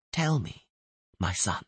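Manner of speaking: soft, quiet coaxing murmur; gentle soothing voice. Acted. Kneeling stranger coaxing a dying man to talk, softly. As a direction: lull him while pressing for names. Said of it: "the gentle voice kept repeating".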